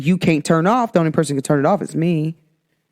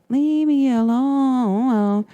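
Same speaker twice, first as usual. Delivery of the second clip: drawn-out plea; 6 semitones higher, much slower than usual. Retold or imitated.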